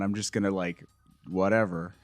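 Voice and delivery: low, soft, retold shrug; cynical resignation. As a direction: trail down as if past caring, imitating him. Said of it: "he's cynical".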